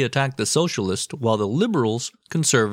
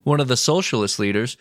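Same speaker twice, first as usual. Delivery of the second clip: usual speaking voice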